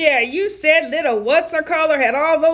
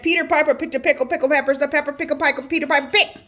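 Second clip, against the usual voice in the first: fast garbled tongue twister; rushed tongue twister; much faster than usual. Pretend. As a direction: gabble it at double speed. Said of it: "Then he probably said".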